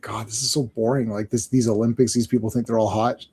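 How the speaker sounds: low, subdued mock boredom. Gladiator scoffing at the Olympic flame, acting bored. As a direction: act mildly bored and unimpressed. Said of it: "'cause he's so bored".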